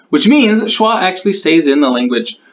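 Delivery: ordinary talk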